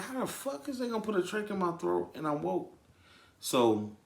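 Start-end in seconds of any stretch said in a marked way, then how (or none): 0.0-2.7 high-pitched incredulous protest; acted alarmed disbelief. Retelling hearing he'd get a trach awake, high alarmed disbelief. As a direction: replay your alarm, pitched way up.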